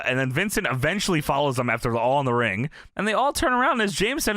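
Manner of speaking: ordinary talk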